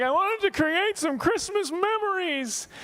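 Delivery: high, slow, whiny imitation of a crying wife; mock crying wife. Comedian mimicking his upset, crying wife, high and slow. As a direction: wail it like a hurt newlywed. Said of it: "She's like".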